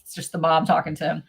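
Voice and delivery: ordinary talk, nothing marked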